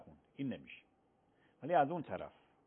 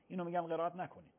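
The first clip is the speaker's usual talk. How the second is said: ordinary delivery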